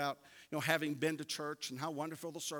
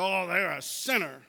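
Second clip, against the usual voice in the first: mock self-righteous; 2 semitones higher, 11 dB louder, slower than usual. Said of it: "look at that other person and say".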